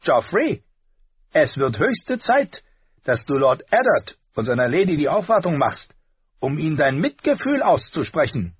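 stern uncle. A narrator's reading. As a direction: tell the boy his duty. Tyrion lectures nephew.

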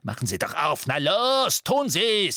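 rising into a high shout; impatient demand. A narrator's reading. Amicus demanding McGonagall open the door, impatient shouting. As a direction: build quickly to a yelled order.

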